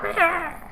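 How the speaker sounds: thin high falling-dragon squeal; high mock scream; imitated dragon screech. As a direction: squeal like a tiny plummeting creature.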